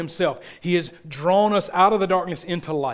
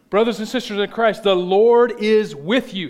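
preaching at full force; 6 semitones higher, 9 dB louder than usual. Acted. Pastor proclaiming scripture to his church, loud ringing preaching. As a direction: proclaim it like a battle cry.

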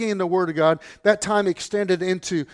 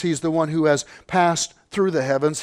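ordinary delivery

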